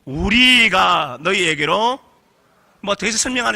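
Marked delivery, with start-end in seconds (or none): 0.0-2.0 very slow, drawn out; slow congregational reading. Shown as a demonstration. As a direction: read it slowly together with the congregation. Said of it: "같이 읽어요. 시작".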